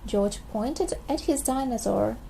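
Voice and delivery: ordinary delivery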